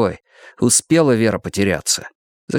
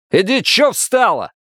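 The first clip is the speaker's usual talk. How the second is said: rude shooing; 10 semitones higher than usual. Acted. Officer shoos Vera.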